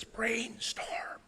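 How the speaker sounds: soft, whispery robot voice; whispered robotic voice. Retold or imitated. Presenter imitating the toy's recording for the audience, whispered robotic voice. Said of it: "sort of a whispered robotic voice".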